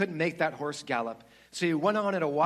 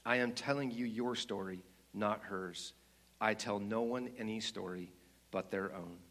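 lion's large deep voice; 4 semitones lower, 5 dB quieter than usual. Acted. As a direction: reveal each deed gently and gravely. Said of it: "said the voice".

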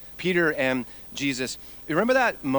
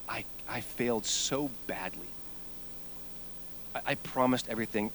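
mild voiced shame; 5 dB quieter than usual. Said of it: "at the utter self-loathing".

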